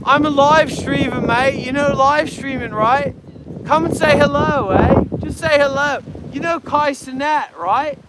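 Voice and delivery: chirpy put-on Australian accent, calling people over; mock Australian accent. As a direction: fake Australian larrikin greeting strangers. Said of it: "I like the Australian kind of shit".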